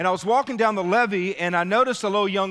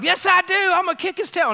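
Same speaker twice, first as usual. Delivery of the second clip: much higher, loud defiant shout; angry yell; 12 semitones higher, 5 dB louder than usual. Retold or imitated. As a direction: bark it back like the furious older brother. Brother yells refusal at pastor.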